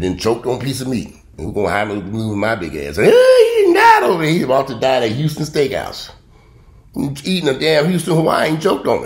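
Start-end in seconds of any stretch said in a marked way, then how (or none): 3.0-6.1 much higher, loud yell "Hey"; mock shout. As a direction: holler like a panicked waiter. Imagined restaurant staff yelling about him.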